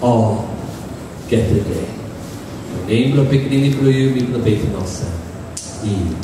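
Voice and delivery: low, hushed closing prayer. Acted. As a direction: pray low and gently.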